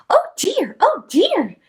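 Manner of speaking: high, fretful oh dear; a hint of a fretting little girl; Little Red hearing bad news; worried Little Red. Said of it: "said Little Red".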